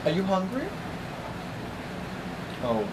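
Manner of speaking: ordinary delivery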